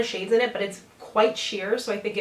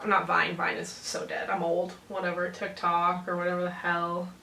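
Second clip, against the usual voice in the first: ordinary talk, nothing marked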